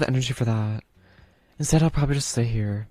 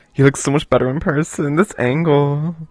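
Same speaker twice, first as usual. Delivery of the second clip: louder and giddy; flustered squeal; 3 semitones higher, 8 dB louder than usual. Real, not acted. Streamer gushes over the Reaper's looks, loud. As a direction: gush loudly.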